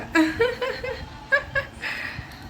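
a high burst of real laughter; delighted laugh. Real, not acted. Mother watching her daughter slip, laughing high and delighted.

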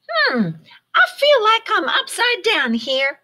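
high, puzzled bird voice; Flap voice. A narrator's reading. Flap hanging upside down, high puzzled bird voice. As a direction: sound a bit confused and high. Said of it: "Flap said".

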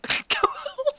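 squeaky slow laughing question; high giggly disbelief. Real, not acted. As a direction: let the laugh pitch it up.

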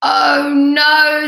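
loud, high moan of dismay; exasperated bear. A narrator's reading. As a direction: groan it as the sleepless bear. Bear kept awake again.

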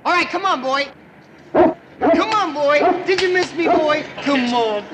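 doting dog talk. Acted. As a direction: coo over the dog like a proud owner. Greeting his dog eagerly.

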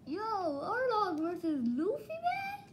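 high-pitched excited exclamation; giddy high fan hype; hyped up. Real, not acted. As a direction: pitch it way up, hyped for a big fight. Hyped for the coming fight.

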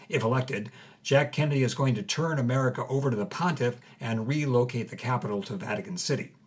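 plain reading voice

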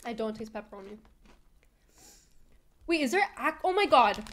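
crunching between words; talking while chewing. Real, not acted. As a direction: mouth full of chips. Seen 0: Left speaker bites a chip mid-phrase, then talks while chewing.

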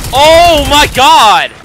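loud, high, slow despairing cry; long angry groan of a yell; exasperated yell. Real, not acted. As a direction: drag it out in disbelief. Frustrated at game.

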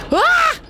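very high, loud scream of fright; frightened scream. Real, not acted. Streamer jump-scared in a horror game, screaming.

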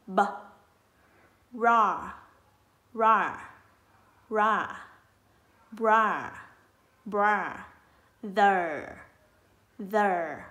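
"b", "ra", "bra", "the" as isolated sounds, each falling; đánh vần từng âm. Shown as a demonstration. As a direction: break "brother" into "b", "ra", "bra", "the", loudly with pauses. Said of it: "Ở đây mình có âm". (tách "b", "ra", "bra", "the", đọc to từng âm)

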